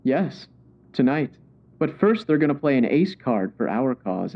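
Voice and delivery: usual speaking voice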